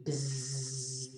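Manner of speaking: long, soft, low buzz; bee buzz. Pretend. Reader showing a bee flying to the flower, buzzing. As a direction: hum a steady bee buzz quietly. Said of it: "A bee".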